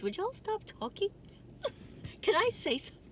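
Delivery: high-pitched playful pleading; mock exasperation. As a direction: squeal a mock complaint at chatty viewers. Mock-scolding chatty viewers.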